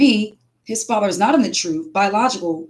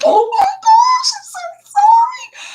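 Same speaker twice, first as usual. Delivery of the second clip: shrieking, much higher and louder, slow and crying; horrified apology; 5 dB louder, slower than usual. Retold or imitated. Reliving her shock at telling the father the egg rolls had pork. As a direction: wail it in shocked dismay. Realizing she fed him pork.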